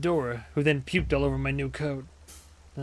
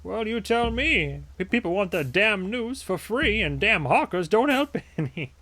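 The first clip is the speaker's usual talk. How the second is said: higher-voiced vendor griping about his trade; grumbling newsie; 7 semitones higher than usual. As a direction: gripe like a put-upon street seller. Newsie complains about business.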